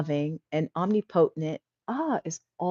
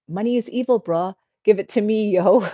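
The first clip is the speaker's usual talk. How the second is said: louder, higher mock street voice; cheeky comment voice; 4 semitones higher, 6 dB louder than usual. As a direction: ham up the cheeky viewer demanding money. Hams up a cheeky viewer comment.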